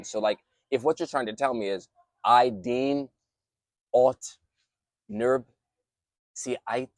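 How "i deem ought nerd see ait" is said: slow, word-by-word backwards speech. Shown as a demonstration. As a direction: sound out each backwards word with pauses. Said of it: "I can speak backwards".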